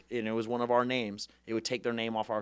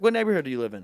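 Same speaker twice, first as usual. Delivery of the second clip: eager campaigner; 4 semitones higher, 9 dB louder, faster than usual. Retold or imitated. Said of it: "I'd be like".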